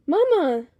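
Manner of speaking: high, loud call; yelling her name. A narrator's reading. Daughter runs across the street to Mama, yelling. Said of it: "yelling her name".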